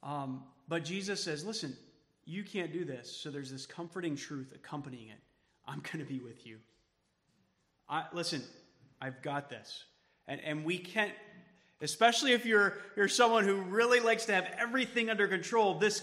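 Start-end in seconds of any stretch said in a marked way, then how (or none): 1.4-9.8 reassuring. Pretend. Pastor voices Jesus reassuring disciples.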